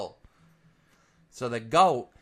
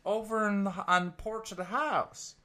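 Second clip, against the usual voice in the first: higher, slow baffled farmer voice; 8 semitones higher than usual. Acted. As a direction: drag it out, puzzled at the goat. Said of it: "The farmer's like".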